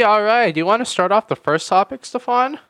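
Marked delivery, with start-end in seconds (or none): none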